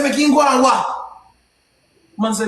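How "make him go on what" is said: higher, indignant retort; retold incredulous comeback; challenging echo. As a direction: throw the words back as a raised challenge. Echoes the remark back, challenging it.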